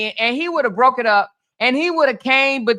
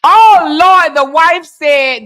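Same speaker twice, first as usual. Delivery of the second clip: loud, slow cry of disbelief; loud scandalized Oh Lord; shocked exclamation; 4 semitones higher, 9 dB louder, much slower than usual. Real, not acted. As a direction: gasp it out loudly, stunned. Shocked at the wife's words.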